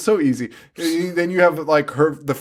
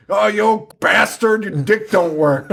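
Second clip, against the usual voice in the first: higher, louder hammed-up yell; mock shouted insult; 6 semitones higher, 4 dB louder than usual. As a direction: spit it like a trashy horror villain. Parody [name] dialogue.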